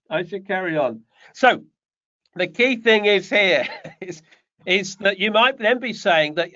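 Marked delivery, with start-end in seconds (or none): none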